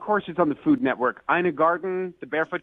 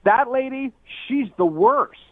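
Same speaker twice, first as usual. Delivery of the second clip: mock loud remark; 9 semitones higher, slower than usual. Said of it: "in a really loud voice".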